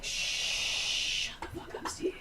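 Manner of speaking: whispered telling-off; mock hushed scolding. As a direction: hiss it under the breath, playfully. Whispered take of the running catchphrase.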